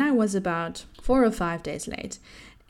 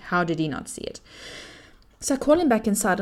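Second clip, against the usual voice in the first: ordinary talk, nothing marked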